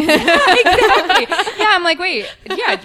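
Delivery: high and loud, giggling; laughing agreement. Retold or imitated. Retold exchange, laughing.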